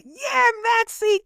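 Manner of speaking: high, drawn-out cheer; a small fan's shout, stretched slow. Retold or imitated. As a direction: cheer him on from the stands. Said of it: "cheer and clap my little heart out".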